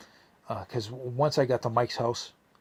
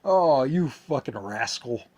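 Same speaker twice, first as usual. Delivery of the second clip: louder teasing, slow "you fucking rascal"; mock amused friend; 4 semitones higher, 7 dB louder than usual. Imagining Peter laughing at the prank, louder and teasing. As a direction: play the friend laughing it off, drawn out. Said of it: "kinda laugh and be like".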